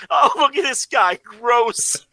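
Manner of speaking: quoting through laughter; high, giggling "gross"; laughing. Retold or imitated. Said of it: "describe me as".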